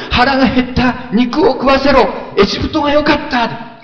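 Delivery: high mock whine of selfish prayer; whiny complaining. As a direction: whine like a spoiled Israelite in the desert.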